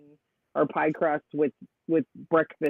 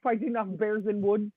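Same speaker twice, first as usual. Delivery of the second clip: higher-pitched mock Russian accent; put-on Russian accent, pitched up; 5 semitones higher than usual. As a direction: imitate a Russian-accented heckler. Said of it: "He said it in the accent".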